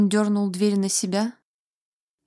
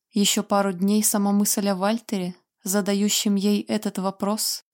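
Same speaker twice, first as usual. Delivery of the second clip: ordinary delivery, nothing marked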